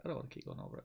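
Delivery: whispered. Shown as a demonstration. Tired of the topic, whispered aside to himself. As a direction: drop to a whisper. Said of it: "They keep dragging me back in".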